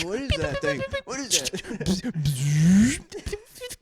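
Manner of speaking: bewildered. Acted. Cop bewildered at laser.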